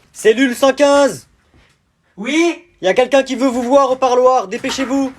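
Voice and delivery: loud, slow official call; guard calling a prisoner to the visiting room. Acted. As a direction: bark it down the cell block.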